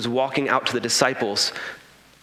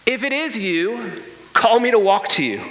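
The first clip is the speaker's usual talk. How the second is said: raised, higher call across the water; 6 semitones higher than usual. Retold or imitated. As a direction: call out to the figure on the waves. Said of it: "Peter calls out".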